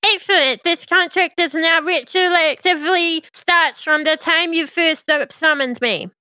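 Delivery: spring the trap, pleased; imp voice. Acted.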